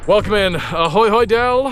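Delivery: loud greeting. Real, not acted. Greeting a friend joining.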